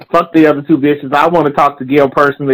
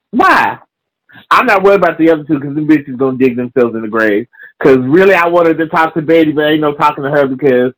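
ordinary delivery

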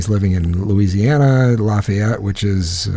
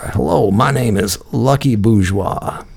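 slow, drawn-out southern intro; lightly voiced Cajun caller; a hint of a Louisiana caller introducing himself; imitating the caller; slower than usual. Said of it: "and he goes".

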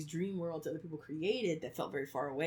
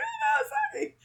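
high wailing, much higher and louder; crying out oh no; 8 dB louder than usual. Retold or imitated. Remembering sobbing at Sailor Moon, wailing high. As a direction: cry out as the heartbroken kid watching. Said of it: "I was crying".

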